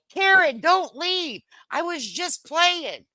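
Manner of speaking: high pleading call. Pretend. Pleading with departing viewer.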